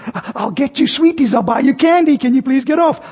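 high, wheedling mother's voice; mother desperately bargaining with her boy; pleading mother. Retold or imitated. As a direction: bribe the child, pitch up high.

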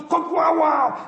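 high, loud door-crash sound effect; door crash noise. Retold or imitated. Boy crashing through the classroom door, loud sound effect. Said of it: "the door goes".

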